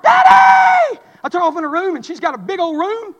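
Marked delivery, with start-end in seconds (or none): 0.0-1.0 high, loud drawn-out yell imitating daughter; mimicked child's shriek for help; daughter's holler. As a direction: holler for Dad across the house. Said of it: "she hollered".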